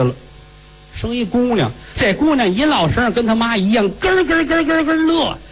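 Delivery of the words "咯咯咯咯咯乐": giggle imitation. Baby giggles like mother.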